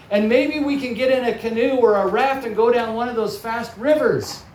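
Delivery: put-on excited kid voice, rising with enthusiasm; eager daughter piping up. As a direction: imitate a girl pitching her own exciting idea. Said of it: "the oldest daughter piped up and said".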